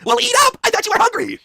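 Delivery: high, shrieky command; mock bossy yell. Wife forcing hungry husband to eat, high yell.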